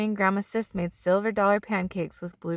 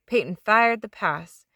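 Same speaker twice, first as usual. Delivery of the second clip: usual speaking voice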